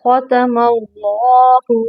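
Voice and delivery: ख़तमल्लाहु with a thick, heavy ल in अल्लाह; ख़तमल्लाहु slow with a full heavy lam. Shown as a demonstration. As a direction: darken the ल in ख़तमल्लाहु. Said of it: "लाम को मोटा करेंगे".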